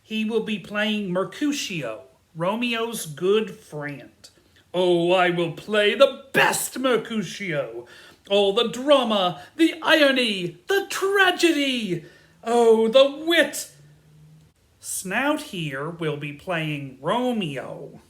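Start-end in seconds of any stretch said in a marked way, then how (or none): 4.7-13.7 loud, high, hammed-up actor's rapture; overjoyed ham actor; grandiose boasting. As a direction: declaim each virtue bigger and higher. Bottom brags about his casting.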